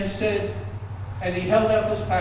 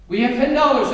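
auctioneer call; 6 semitones higher, 8 dB louder than usual. Acted. Auctioneer calls the bid.

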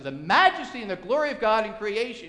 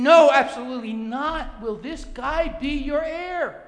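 loud, high, drawn-out "No, absolutely not"; emphatic refusal; 6 semitones higher, 6 dB louder, slower than usual. Acted. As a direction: thunder out "No" and stretch "not". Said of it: "It's more emphatic in the original language".

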